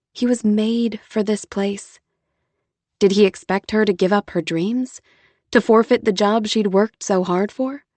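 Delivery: usual speaking voice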